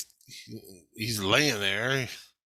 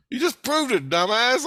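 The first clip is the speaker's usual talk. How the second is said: scolding retort; 11 semitones higher, 5 dB louder than usual. Retold or imitated. Retold insult in argument.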